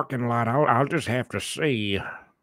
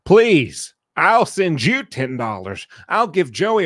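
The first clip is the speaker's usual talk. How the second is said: high, loud desperate plea; loud pleading; 5 semitones higher, 7 dB louder than usual. Real, not acted. Host loudly begging Kristen to stop the dirty talk. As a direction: beg her loudly to stop.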